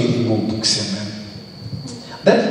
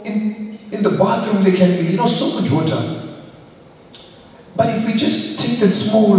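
raised, fervent preaching voice, pitched higher; 8 semitones higher, 4 dB louder than usual. Acted. Pastor urging the congregation to speak up, fervent preaching. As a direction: preach it out with full conviction.

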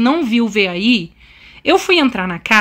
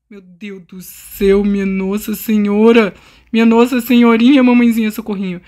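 dramatic despair; 3 semitones lower than usual. Real, not acted. Desperate plea while fleeing.

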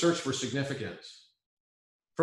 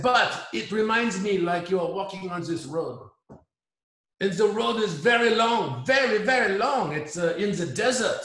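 higher-pitched imitation of a French man's English with a heavy French accent; 6 semitones higher, 3 dB louder than usual. French guy praising Robert, in a heavy French accent. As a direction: play the French guy lecturing him, in a thick French accent. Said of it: "very heavy French accent".

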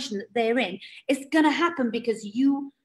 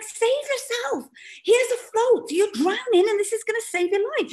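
pitched way up, begging them to take it; mock frustrated pleading; 9 semitones higher than usual. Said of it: "And you're like".

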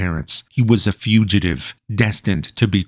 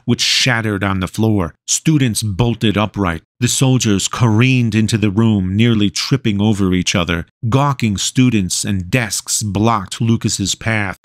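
ordinary delivery

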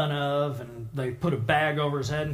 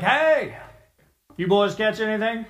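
loud call across the water to the boat; 3 semitones higher, 5 dB louder than usual. Retold or imitated. Jesus calling to his disciples on the boat, loud hail. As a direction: hail them from the shore.

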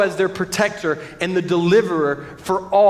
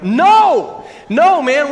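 loud, higher, emphatic refusal; 8 semitones higher, 7 dB louder, much slower than usual. Real, not acted. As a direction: reject it loudly and playfully. Rejecting the gentle wake-up.

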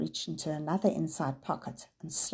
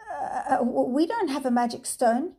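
hesitant, stammering denial; nervous boy caught lying; weakly; 7 semitones higher, 5 dB louder than usual. A narrator's reading. Peter nervously denies having the stone, stammering. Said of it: "Peter said weakly".

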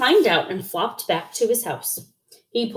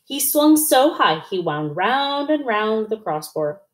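usual speaking voice

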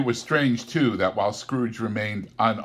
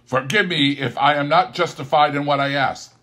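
Scrooge; 7 semitones higher, 9 dB louder than usual. Acted. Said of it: "said Scrooge".

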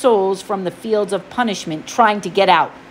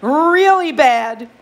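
higher, slow and earnest satyr; Grover voice; 4 semitones higher than usual. Acted. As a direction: nervously chime in. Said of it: "Grover added helpfully".

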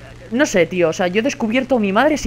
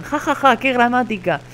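mocking laugh; 5 semitones higher than usual. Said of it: "En plan".

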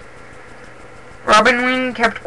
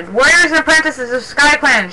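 raised, carrying address; higher, projected announcement; called out; 7 semitones higher, 3 dB louder than usual. A narrator's reading. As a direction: call the cats to attention. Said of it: "she called".

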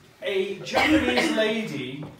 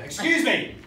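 forceful call; 4 semitones higher than usual. Retold or imitated. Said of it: "it was a forceful "excuse me".

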